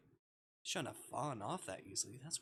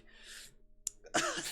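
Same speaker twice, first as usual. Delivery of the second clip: high laugh. Real, not acted. Reacting to creepy scene.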